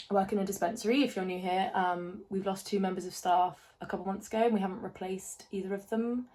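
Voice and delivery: ordinary delivery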